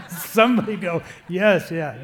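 loud plea to the crowd; loud appeal. Real, not acted. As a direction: coax the men to answer. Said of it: "Isn't it, men?".

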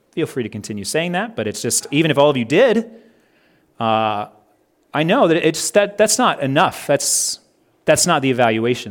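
ordinary talk, nothing marked

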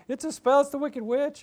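high, loud alarm; frantic warning cry; panicked. Acted.

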